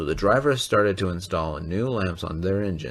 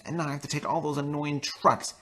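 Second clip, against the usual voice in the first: indignant complaint; 9 semitones higher than usual. Acted. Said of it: "cried James".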